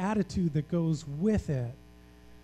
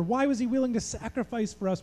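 usual speaking voice

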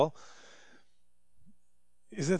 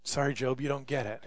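soft, low, blunt answer; hushed dismissive reply; quiet shrug; 5 semitones lower, 11 dB quieter than usual. Acted. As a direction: say it softly, matter-of-fact. Quiet mock answer to Job.

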